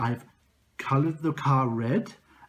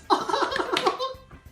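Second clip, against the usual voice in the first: high zany giggle. Acted. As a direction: cackle high with glee. Host's character laugh.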